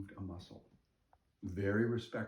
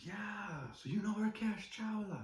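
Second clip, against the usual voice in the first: high-pitched imitation of delighted recognition; 12 semitones higher than usual. Restaurant owner hearing a mutual friend's name, imitated delight. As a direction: act out the penny dropping, pitched way up.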